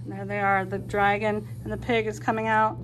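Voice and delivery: plain reading voice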